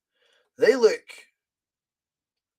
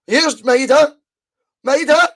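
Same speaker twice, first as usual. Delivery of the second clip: excited shout; 8 semitones higher, 9 dB louder than usual. Real, not acted. Chance on goal.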